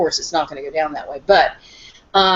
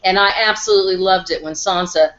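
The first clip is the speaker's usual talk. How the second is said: normal talking voice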